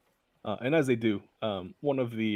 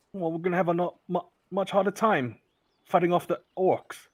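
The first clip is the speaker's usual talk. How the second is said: louder, higher, official voice; stern constable; 7 semitones higher, 7 dB louder than usual. Acted. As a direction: assert her authority. Constable introduces herself.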